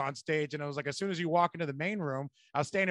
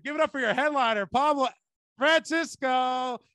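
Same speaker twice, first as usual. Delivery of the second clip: loud, higher announcer build-up; emcee introduction; 7 semitones higher, 4 dB louder than usual. Retold or imitated. Introducing the headliner to the crowd, loud MC hype.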